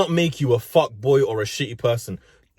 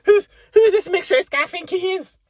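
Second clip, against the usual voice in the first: high mocking whiny voice, pitched up; squeaky mock complaint; mock whining viewer; 12 semitones higher than usual. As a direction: sneer it like a bitter viewer. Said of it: "I bet you're bitching right the fuck now".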